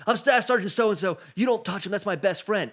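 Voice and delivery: high, loud soldier bark; staff sergeant standing up. Retold or imitated. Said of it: "stands up and he goes".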